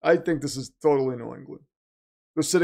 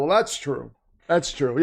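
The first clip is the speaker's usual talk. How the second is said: ordinary talk, nothing marked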